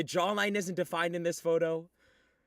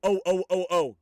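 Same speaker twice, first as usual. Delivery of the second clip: excited outburst; 8 dB louder than usual. Real, not acted. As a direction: burst out with sudden hype. Reacting to an uploaded edit.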